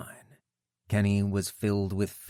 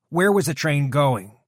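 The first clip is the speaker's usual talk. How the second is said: loud, higher, insistent question; demanding; 7 semitones higher, 8 dB louder than usual. A narrator's reading. Kenny demanding where the train went, forceful. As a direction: hammer the question home.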